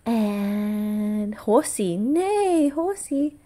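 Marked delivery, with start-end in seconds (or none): 2.0-2.7 lightly imitated high whinny; a hint of a horse, pitched up; playful horse neigh. Parent naming the horsey for toddler, playful neighing.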